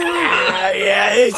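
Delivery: high, loud joking yell; joking shout. Acted. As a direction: shout along in the joke.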